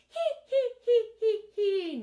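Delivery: loud, squealed "hee hee" giggle; staged giggle at the top of the voice; very high laugh. Shown as a demonstration. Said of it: "nice and high".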